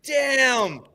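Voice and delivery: high exclaimed awe; awed excitement. Real, not acted. Amazed by the fighters.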